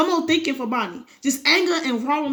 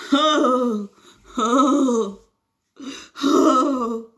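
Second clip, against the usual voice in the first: pained groans; 4 dB louder, much slower than usual. Real, not acted. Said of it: "That hurt so bad".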